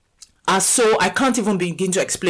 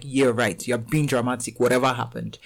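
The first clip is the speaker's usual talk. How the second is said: dismissive; 6 semitones lower, 4 dB quieter than usual. Retold or imitated. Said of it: "the one that is like".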